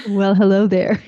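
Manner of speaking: loud, laughing attempt at a sultry radio host; sexy late night DJ voice. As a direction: go for smoky late night DJ, then crack up. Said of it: "trying to put on my most sexy late night D J smutty voice".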